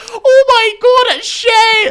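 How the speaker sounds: very high, loud slow shriek; excited scream. Retold or imitated. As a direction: scream with delight. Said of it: "all I hear is Thomas going".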